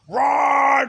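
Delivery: shouted high and loud; whole crowd roaring the answer, high and loud; crowd scream. Retold or imitated. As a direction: yell it back like the whole party. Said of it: "every person in the place screamed it".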